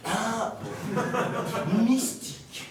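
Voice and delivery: high, amused jeer through laughter; laughing mockery. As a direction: scoff at the word, laughing. Friend mocks the word mystique. (moquerie en riant)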